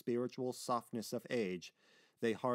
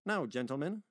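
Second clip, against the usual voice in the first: higher, raised address to the room; louder tone; 5 semitones higher, 4 dB louder than usual. Acted. Meyer turning to the gentlemen, in a louder tone. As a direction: call the meeting to order. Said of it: "he added in a louder tone".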